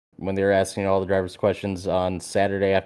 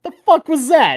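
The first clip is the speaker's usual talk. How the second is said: much higher, louder stunned outburst; baffled disbelief; 6 dB louder than usual. Retold or imitated. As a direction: react as if nothing made sense. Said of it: "I'm like".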